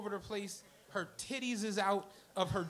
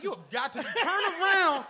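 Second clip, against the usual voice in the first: high, loud and laughing; excited laughing urge; 6 semitones higher, 8 dB louder than usual. Retold or imitated. Telling friend to look at the Lambo, excited laugh. Retold urgent warning to friend.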